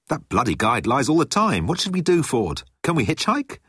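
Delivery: high, swooping voice of a flustered character; agitated Arthur. A narrator's reading.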